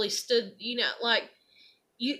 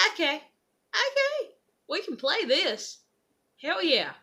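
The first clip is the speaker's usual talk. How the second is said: sarcastic resolve; 6 semitones higher, slower than usual. Retold or imitated. Deciding to fight back.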